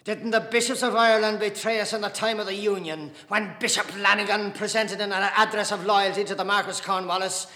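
loud, high volley of accusing questions; angry tirade. A narrator's reading. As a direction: hammer each accusation home in anger. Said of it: "His face was glowing with anger".

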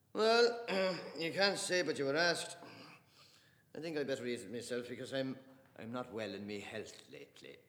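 loud, high, wry mock complaint; joking father. Father teasing the silent table, wry and loud. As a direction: wink while you grumble.

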